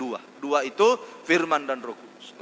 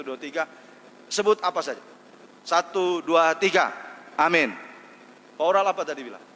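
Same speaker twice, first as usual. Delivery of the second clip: ordinary talk, nothing marked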